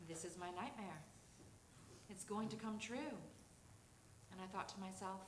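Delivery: usual speaking voice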